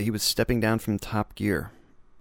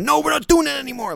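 much higher, louder, hammed-up stern refusal; mock Captain Serious; 8 dB louder than usual. Mocking the presenter scolding the crew, hammed-up and high. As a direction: play a pompous host shutting down the joke. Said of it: "like Captain Serious".

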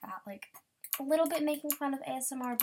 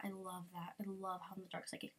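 ordinary talk

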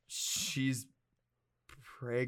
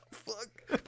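constipation acting; 10 semitones higher, 6 dB quieter than usual. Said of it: "constipation acting where I'm like".

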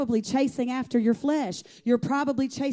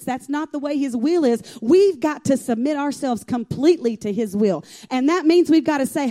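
louder, hammering each "not"; fervent preaching; 3 semitones higher, 6 dB louder than usual. Acted. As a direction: drive the point home to the congregation. Preaching at full force.

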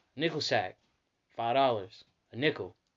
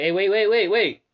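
loud, high repeated halt; urgent mock shout; alarmed stop; 7 semitones higher, 11 dB louder than usual. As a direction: call out to stop him. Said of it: "They're like".

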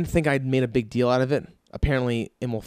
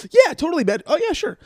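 mock eager agreement; 3 dB louder than usual. Mocking salesman's fake agreement.

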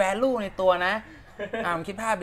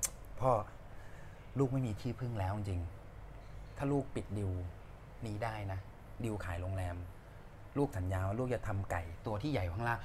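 low, soft, slow prayer to a revered king; murmured plea for divine help; pleading prayer; 9 semitones lower, 11 dB quieter, slower than usual. Retold or imitated. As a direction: re-enact a humble vow at a shrine, hushed and deep. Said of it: "ขึ้นไปไหว้พระเจ้าตากเว้ย แล้วก็บอกว่า".

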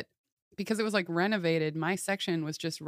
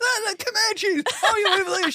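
much higher, louder gushing exclamation; hyper excited exclaim; 14 semitones higher, 8 dB louder than usual. Retold or imitated. Friend mimics Allie bursting into the Airbnb, hyper excited. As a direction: gush it like a kid bursting in. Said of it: "stomping around".

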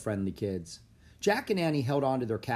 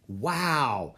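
wowed, with a big grin; an impressed wow through a grin; delighted little exclamation; grinning wow; 2 semitones higher, 3 dB louder than usual. A narrator's reading. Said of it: "said with a big grin".

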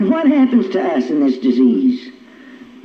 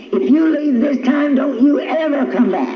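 higher, half-acted yell; mock scream; 7 semitones higher than usual. Wife screaming at husband leaving, mild mock yell. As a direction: threaten him as he leaves, lightly voiced. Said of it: "and I'd scream".

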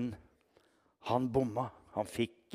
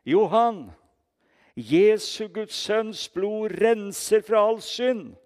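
high, loud, drawn-out call; re-enacted shout into an old man's ear; shouting into his ear; 8 semitones higher, 7 dB louder, slower than usual. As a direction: call out slowly to someone dying. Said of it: "så ropte jeg inn i øret hans".

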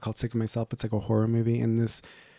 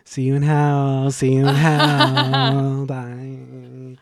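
slow, drawn-out mock podcaster voice; loud, stretched-out vowels on a lazy farewell; Red Scare sign-off; 3 semitones higher, 7 dB louder, much slower than usual. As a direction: draw out every last word, mocking the hosts. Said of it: "how Red Scare ends their podcast".

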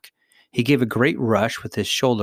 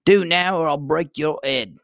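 high, raised-voice threat; a princely threat, pitched up; threatening; 6 semitones higher, 3 dB louder than usual. A narrator's reading. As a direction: warn him off, starting high and loud. Prince threatens the noisy prisoner.

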